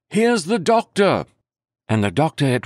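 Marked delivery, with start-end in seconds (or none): 0.1-1.3 hearty call. Acted. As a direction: call it out so they hear it inside. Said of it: "clear, hearty voice hailing us".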